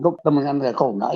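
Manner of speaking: ordinary delivery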